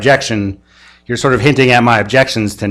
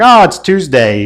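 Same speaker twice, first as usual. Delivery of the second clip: a light, offhand shrug of a line, pitched higher; mildly flippant cop, casual and higher; 6 semitones higher, 4 dB louder than usual. Retold or imitated. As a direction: toss it off like nothing matters. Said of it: "very flippantly said".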